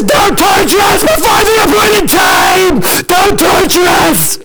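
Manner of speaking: high, drawn-out screamed plea; berserk screaming. Acted. Demoniac throwing himself at Jesus' feet, berserk screaming. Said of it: "he's going berserk".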